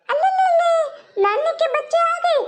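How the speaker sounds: put-on high, slow baby talk to little ones; cooing baby talk. Grandmother welcomes arriving grandchildren, high slow cooing baby talk. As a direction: coo over the grandkids like they're babies.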